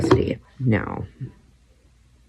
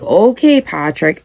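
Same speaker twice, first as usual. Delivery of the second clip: mock sarcastic retort; 6 semitones higher, slower than usual. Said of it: "and I'd be like".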